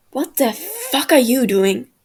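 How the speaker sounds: loud, furious yell at the intruder; angry shout. Acted. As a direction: shout it out, caught red-handed. Tommy catches Wilbur in his bag.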